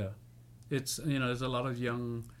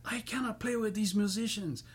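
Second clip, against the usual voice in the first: high-pitched mock exasperation; flustered singer, imitated; 10 semitones higher than usual. Singer refuses to go on with the band, imitated flustered protest. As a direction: act out a flustered complaint, pitched way up. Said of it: "she was getting all flustered".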